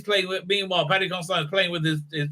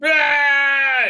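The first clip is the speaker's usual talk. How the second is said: much higher, loud furious yell; angry outburst; 11 semitones higher, 5 dB louder, much slower than usual. Retold or imitated. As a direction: explode with fury. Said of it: "He's like".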